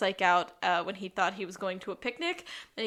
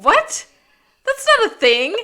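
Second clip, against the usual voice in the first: higher and louder, disbelieving; loud incredulous; 11 semitones higher, 13 dB louder than usual. Retold or imitated. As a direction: throw it back in disbelief. Sean doubts the excuse.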